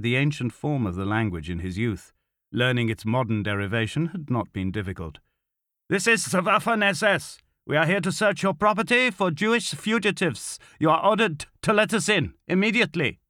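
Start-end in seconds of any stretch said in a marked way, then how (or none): 5.9-13.2 high, forceful official announcement; barked order. A narrator's reading. SS officer demanding entry at the door, barking orders. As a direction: call it out sternly through a closed door. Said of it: "he barked in Greek".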